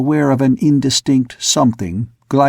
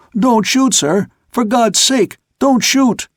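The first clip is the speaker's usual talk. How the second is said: high pleading cry, higher each phrase; frightened shout; 10 semitones higher, slower than usual. A narrator's reading. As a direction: beg him not to fire, terrified. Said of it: "shouted out in a frightened voice".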